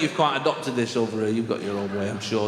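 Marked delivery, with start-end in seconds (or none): none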